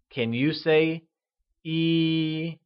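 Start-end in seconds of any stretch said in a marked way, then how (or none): 1.6-2.6 E held high and level in Mandarin. Shown as a demonstration. Teacher modelling E with a high level tone for learners. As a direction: sing E out on one steady high pitch. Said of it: "pronounced with a high level tone".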